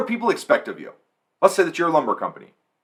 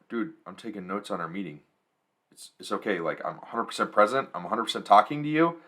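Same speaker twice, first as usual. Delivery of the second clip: quiet reassurance; 6 semitones lower, 8 dB quieter than usual. Retold or imitated. Acted reassurance to suspicious client.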